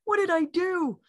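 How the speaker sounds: high, alarmed cry of dismay; high-pitched mock panic; retold panic. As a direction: relive the moment you fear you caused harm. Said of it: "I'm like".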